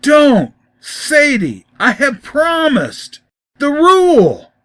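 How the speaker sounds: high, loud anguished cry; pained cry. A narrator's reading. Joe crying out in pain against her temptation, high and loud. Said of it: "There was pain in his voice as he cried".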